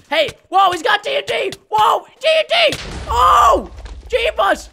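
very high, loud panicked shouting; real high-pitched alarm; startled alarm. Real, not acted. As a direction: yell in shock at a sudden attack. Sudden TNT attack on base.

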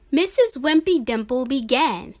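ordinary delivery

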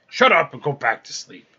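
loud, cross command; storyteller acting a fed-up giant; irritated giant. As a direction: shut the argument down loudly. Giants quarrel, one ends it.

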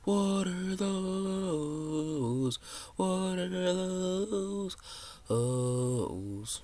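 sing-song mock meme wail; slow drawn-out meme. Kid gaming on his phone, mocking another player with a drawn-out wail. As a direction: stretch each word out slow and high, mocking. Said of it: "drawn-out meme repeat".

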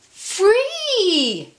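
high ringing shout; joyful cry. Acted. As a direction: cry it out in triumph. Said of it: "said Louise again".

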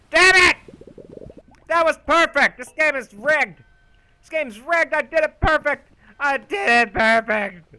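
high, loud exasperated yell; frustrated outburst. Real, not acted. Gamer missing a jump by a hair, frustrated yell. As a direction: vent about the near miss. Angry gamer blames game.

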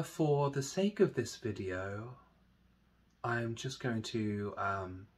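slow, soft meditation voice with long pauses; guided meditation. Acted. Teacher leading listeners through a body meditation, slow soft guiding voice. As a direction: guide them gently through it, hushed and unhurried.

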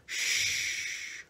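gentle unvoiced shh like a mother; soft shush. Shown as a demonstration. A mother hushing a child, softly. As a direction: hush like a mother soothing a child. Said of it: "be like the mother and just go".

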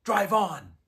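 loud, high, commanding shout; barked command. A narrator's reading. Witch orders the sledge away, shouting imperiously.

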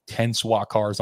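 ordinary talk

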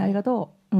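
ありがとう rising on とう; Kansai intonation. Shown as a demonstration. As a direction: say ありがとう with とう lifted. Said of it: "が上がりますけど".

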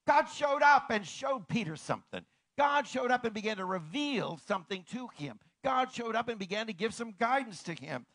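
loud, high triumphant proclamation; fervent preacher's climax; preaching at full force. Acted. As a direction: proclaim the revelation with fire.

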